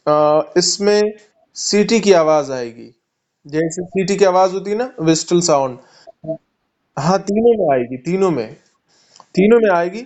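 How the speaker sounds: ordinary delivery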